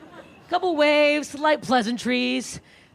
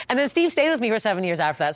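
ordinary delivery

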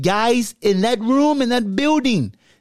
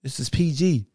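a calm voice telling him off for cursing; scolding voice; 7 semitones lower, 5 dB quieter than usual. Pretend. The clean voice scolding Petty Hawk for cursing, low and soft. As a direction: lower and softer, chiding.

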